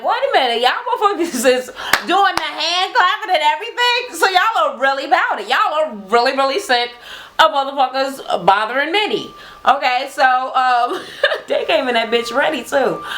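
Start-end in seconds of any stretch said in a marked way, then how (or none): 0.0-9.3 high, incredulous voice with a laugh; high disbelieving exclaim; amazed quote. Retold or imitated. Speaker reacting to twins' hand clapping, high and amazed. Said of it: "I'm like".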